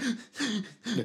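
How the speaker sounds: high, gasping mock sobs; imitated crying hiccups. Dad shows how his crying son sounded, imitated gasping sobs. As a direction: catch the breath in little sobbing sniffs. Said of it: "the crying thing where they're like".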